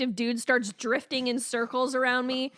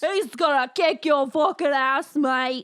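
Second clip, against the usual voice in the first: mock Australian accent; 5 dB louder than usual. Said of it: "mocking Australian accent".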